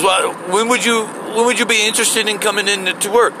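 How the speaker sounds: lightly mocking manager voice; imitating the store lady.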